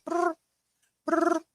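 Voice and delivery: a high playful mock growl; imitated high dog growl. Showing viewers how her puppy growls, high mock growl. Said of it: "he'll be like".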